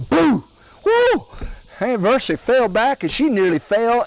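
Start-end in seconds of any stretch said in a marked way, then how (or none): 0.1-1.2 much higher, loud prankster holler; husband jumping out of the corn crib to scare his wife, loud holler; hollered boo. Retold or imitated. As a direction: leap out and holler to scare her. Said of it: "jumped out and hollered".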